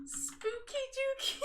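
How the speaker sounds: high squeal of laughter on the name; laughing out the name. Real, not acted. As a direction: burst out laughing as you say it.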